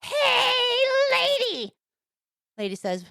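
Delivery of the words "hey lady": high, drawn-out parrot squawk; talking parrot. Acted. Parrot calls to a lady walking by, high parrot voice. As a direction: stretch it out like a shrill talking parrot. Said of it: "The parrot says".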